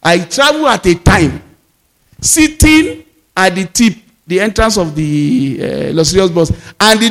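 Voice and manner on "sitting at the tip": normal talking voice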